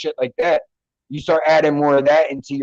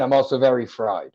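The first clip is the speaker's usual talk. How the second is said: usual speaking voice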